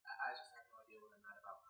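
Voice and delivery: fast, breathy whisper aside; confiding the joke in a whisper. Real, not acted. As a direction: drop to a quick whisper. Aside after mock yelling.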